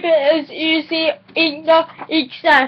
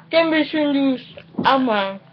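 usual speaking voice

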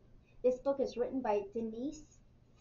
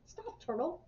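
higher-pitched, mild calling out; crying out; 6 semitones higher than usual. A narrator's reading. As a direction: call out lightly to stop a friend. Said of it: "cried Skunk".

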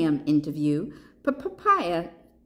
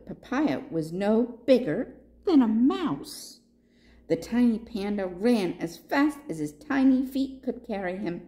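ordinary delivery, nothing marked

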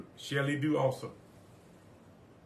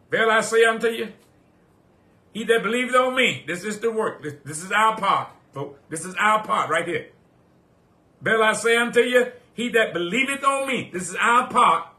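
preaching at full force; 6 semitones higher, 7 dB louder than usual. Acted. Pastor proclaiming Jesus's words to the church, full-force preaching. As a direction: proclaim it like thunder from the pulpit. Preacher returns to shouted verse.

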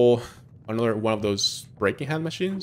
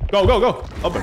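loud, high go go go; urgent shout; 7 semitones higher, 5 dB louder, faster than usual. Real, not acted. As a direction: yell at the screen to hurry. Urging in game.

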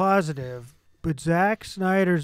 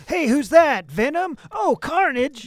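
higher, louder goofy hero surprise; mock excitement; 9 semitones higher, 6 dB louder, slower than usual. Mocking the movie's villain parade, high giddy voice.

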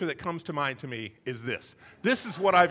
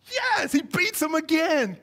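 loud, high, drawn-out exclamation; slow, high-pitched outburst; emphatic shout; 9 semitones higher, 6 dB louder, slower than usual. Real, not acted. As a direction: shout the answer with exasperation. Preacher shouts the answer to his question.

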